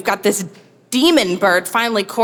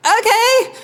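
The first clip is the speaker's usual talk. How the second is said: much higher, louder chirpy agreement; mock bright okay; 5 dB louder than usual. To her husband, chirpy mock agreement. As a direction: chirp a quick yes.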